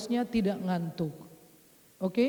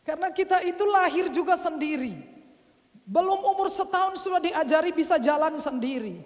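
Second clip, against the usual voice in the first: preacher building up, louder and higher; rousing sermon; 5 semitones higher, 4 dB louder than usual. Acted. Preacher rousing the congregation, loud and rising. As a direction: drive the point home to the congregation.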